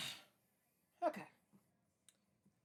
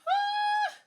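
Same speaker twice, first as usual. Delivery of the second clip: frustrated yell; 15 dB louder than usual. Real, not acted. Frustrated outburst.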